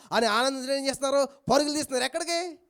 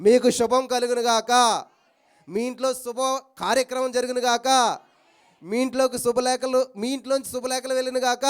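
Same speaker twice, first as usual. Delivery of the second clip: pronouncing blessing; 4 dB louder than usual. Real, not acted. Preacher pronouncing a blessing over the congregation, loudly. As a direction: declare the blessing over them. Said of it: "నేను మీకు చెప్తున్నాను ఇప్పుడు".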